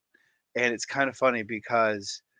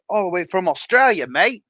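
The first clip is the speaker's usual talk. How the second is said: mock Australian; 7 semitones higher, 7 dB louder than usual. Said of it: "if you ever want to sound Australian".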